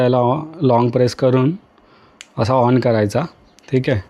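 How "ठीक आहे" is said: ordinary talk, nothing marked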